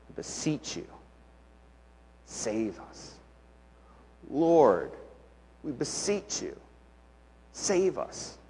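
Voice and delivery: whispered, very soft begging; whispered plea. Retold or imitated. Israelites begging Jesus to save them, whispered plea. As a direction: whisper a desperate prayer. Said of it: "they ask him to save them".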